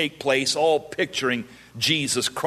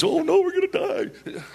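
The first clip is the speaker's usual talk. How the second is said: mock panic.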